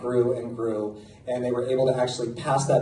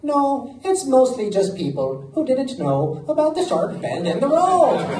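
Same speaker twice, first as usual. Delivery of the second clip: put-on awful Irish accent; 9 semitones higher than usual. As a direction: badly mimic an old Irish local, pitched way up. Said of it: "my Irish accent, I know, is awful".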